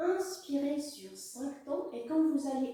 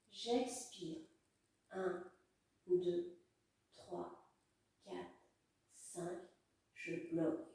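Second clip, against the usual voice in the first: soft, fading breath count; guided breathing count; 4 semitones lower, 9 dB quieter, slower than usual. Acted. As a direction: count softly, letting it fade.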